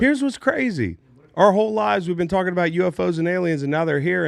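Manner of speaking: high, worked-up disbelief; rising alarm, pitch way up; excited. Real, not acted. Host worked up about aliens.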